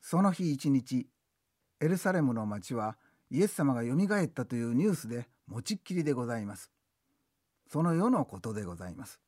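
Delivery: rakugo-style narrator ending the scene; storyteller narration. A narrator's reading. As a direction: narrate formally, slowing at the start.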